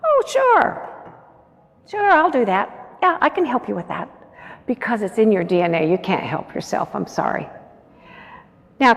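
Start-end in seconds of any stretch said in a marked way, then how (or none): 0.0-4.1 high, bright instant agreement; eager yes. Retold or imitated. Caregiver eagerly agreeing to help someone. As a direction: chirp a reflexive yes to a request. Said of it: "You say".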